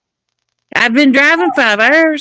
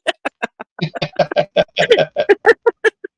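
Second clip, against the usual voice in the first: long, high, softening laugh; real laughter; 4 semitones higher than usual. Real, not acted. Storyteller laughs at own excuse to the officer, real laughter. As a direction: crack up at your own punchline.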